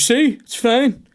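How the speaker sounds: hammed-up smug manager. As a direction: play the proud boss. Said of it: "And he goes".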